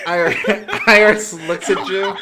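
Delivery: real laughter breaking through loud, high narration; laughing. Real, not acted. Game master cracks up at players' command, laughing through words. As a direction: crack up while trying to narrate.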